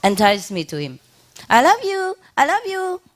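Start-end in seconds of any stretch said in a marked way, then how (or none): 1.5-3.0 very high, sweet-talking parrot voice; enticing bird. Retold or imitated. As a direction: call out high to lure the owner over. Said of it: "he want to entice me".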